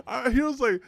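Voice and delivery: high squealing laugh through the words; helpless real giggling fit; breaking into laughter. Real, not acted. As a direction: lose it laughing mid-sentence. Laughs at bringing wrong character.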